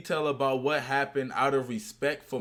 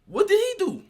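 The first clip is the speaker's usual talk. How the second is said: much higher, louder disbelief; baffled question; 7 semitones higher, 4 dB louder than usual. Real, not acted. Host asking the co-host, baffled and incredulous. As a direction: demand an answer, astonished.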